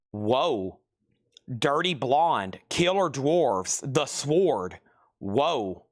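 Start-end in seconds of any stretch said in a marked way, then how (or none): 0.1-0.8 low, drawn-out whoa of amazement; a low, stunned whoa; awed whoa. Real, not acted. Awed by rare records. 5.2-5.9 low, drawn-out whoa of amazement; a low, stunned whoa; awed whoa. Real, not acted. Awed by rare records.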